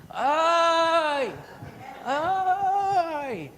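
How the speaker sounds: very high, loud "ay" cry, imitating; mock groaning wail. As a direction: cry out like the complaining smoker.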